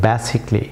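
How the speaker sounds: basically slowly as ba-si-cli, a silent; drawn-out model of basically without the a; correct pronunciation. Shown as a demonstration. Teacher repeats correct basically slowly for learners. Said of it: "not basically".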